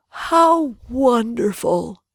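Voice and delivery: slow, lightly voiced old scholar; drawn-out praise from an old man; old geographer. A narrator's reading. Geographer speaks.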